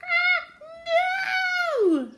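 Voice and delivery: very high, loud squealing scream; terrible screaming. A narrator's reading. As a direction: shriek in drawn-out protest. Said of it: "came the terrible screams".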